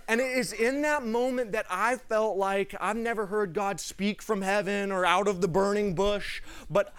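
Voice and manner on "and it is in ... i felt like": ordinary talk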